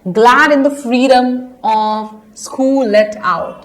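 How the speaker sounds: slow, measured reading of a verse; poem recitation. Acted. Teacher reading the poem lines to her class, slow and measured. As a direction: read each line out slowly and clearly.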